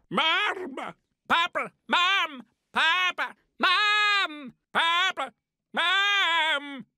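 high wailing calls for parents; lost child calling. Retold or imitated. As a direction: call out like a lost boy. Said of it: "Turkish lad who'd lost his parents".

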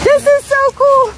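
delighted squeal. Real, not acted. Delighted by the dogs playing.

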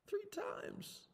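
high, breathless whisper; broken whisper. Acted. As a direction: let it collapse into a whisper. Peter echoes his denials.

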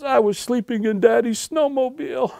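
put-on high, small child voice; little boy. Sleepy-eyed boy answering his mother, little boy voice. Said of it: "this little boy ... he goes".

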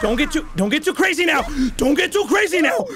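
high, fast nervous warning. Real, not acted. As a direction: warn yourself on the edge.

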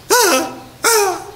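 high mock shriek of the wife; mock scream. Preacher imitating his wife's scream at the wreck, high shriek. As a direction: squeal like a startled passenger. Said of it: "She always thinks there's a way out".

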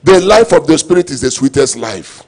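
ordinary talk, nothing marked

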